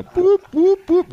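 mock alarm beeps, jumping high and loud. Host imitates his brain's alarm after a bad joke, with mock beeps. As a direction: beep like a warning light going off. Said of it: "my little red light on the, my brain dashboard went off".

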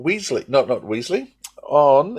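ordinary delivery

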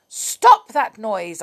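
insist, fed up; cross witch command. A narrator's reading. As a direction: witch ordering the bellman to stop. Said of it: "looked crossly out of her window".

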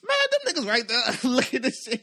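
high, loud excited call; excited pointing out. Retold or imitated. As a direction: blurt it out loudly clueless. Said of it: "I'm like".

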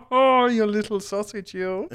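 high, laughing flamboyant coo; laughing tease. Acted. Eriken teasing Titus while laughing, high voice. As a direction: tease him through a laugh.

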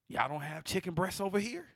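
put-on indignant customer question; mock yell. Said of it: "she yells at the lady".

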